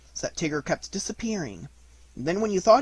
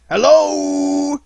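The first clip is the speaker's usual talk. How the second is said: calling out; 11 semitones higher, 12 dB louder than usual. Acted. Tigger calling in the mist.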